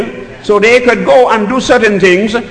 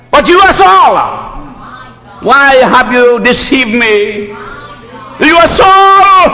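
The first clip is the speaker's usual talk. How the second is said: witch screaming; 6 semitones higher, slower than usual. Acted. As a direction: shriek it at Saul, betrayed. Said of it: "She screamed out".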